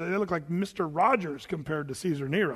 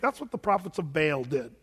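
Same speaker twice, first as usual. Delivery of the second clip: ordinary talk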